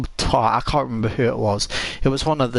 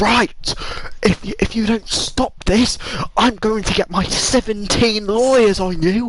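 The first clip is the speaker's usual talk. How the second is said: teacher's threat; 8 semitones higher than usual. Retold or imitated. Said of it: "And the guy goes".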